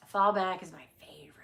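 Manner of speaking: fast unvoiced whisper. Real, not acted. Whispering her favorite to viewers. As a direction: breathe it as a quick secret.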